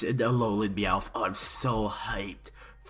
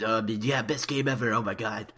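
ordinary talk, nothing marked

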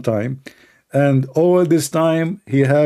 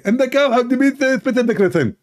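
high-pitched, emphatic put-on voice; insistent, high mock-divine instructions; Allah; 11 semitones higher than usual. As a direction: hand down orders in a comic high voice. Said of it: "Allah, he answered".

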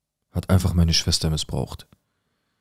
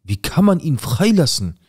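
outraged; 8 semitones higher than usual. Retold or imitated. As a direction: hurl the question in disbelief. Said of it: "ich bin durchgedreht".

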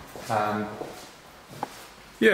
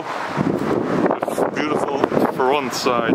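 ordinary talk

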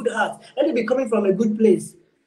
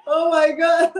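laughing; 5 semitones higher, 4 dB louder, slower than usual. Real, not acted. Laughing recalling funny pastor.

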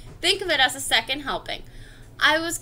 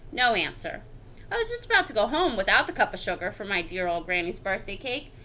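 ordinary delivery

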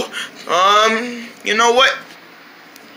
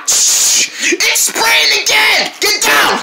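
higher, drawn-out warning yell; alarmed shout; 11 semitones higher, much slower than usual. Acted. Friend warning the others to get down, alarmed shout. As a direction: yell a warning to take cover. Spray bottle attacks again.

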